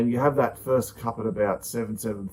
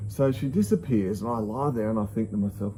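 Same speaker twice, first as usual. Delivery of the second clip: normal talking voice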